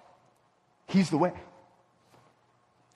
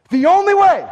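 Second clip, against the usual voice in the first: loud emphatic; 9 semitones higher, 8 dB louder than usual. Real, not acted. Preacher's emphatic climax.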